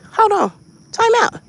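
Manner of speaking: high, loud protest; calling a halt. Retold or imitated. Patient halting the nurse wheeling him, loud protest. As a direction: shout it sharply high to stop them.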